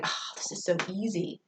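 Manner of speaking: soft, offhand pupil voice; Brody. Acted. Teacher imagines a pupil finding it easy, quiet nonchalance. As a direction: toss it off quietly, as if it's obvious. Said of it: "And Brody going".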